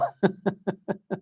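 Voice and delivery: high, chuckling laugh; a run of short, high chuckles; amused laugh. Real, not acted. Laughs at the ASMR joke.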